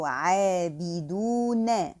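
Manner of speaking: عابدون slow and light, ayn from the throat; careful tajweed word; light recitation. Shown as a demonstration. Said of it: "pronounce the whole word lightly".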